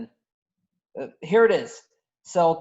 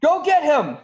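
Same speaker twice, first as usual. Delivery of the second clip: higher, louder command; urgent shout; 9 semitones higher, 6 dB louder than usual. Acted. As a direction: yell the order at the guards. Wookiee breaks loose, alarm.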